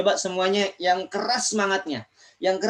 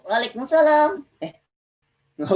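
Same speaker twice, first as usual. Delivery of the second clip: much higher, eager puppet voice; puppet friend voice; 9 semitones higher than usual. Acted. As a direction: pitch it way up like a hand puppet. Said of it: "Temen Kak Ojan udah nggak sabar".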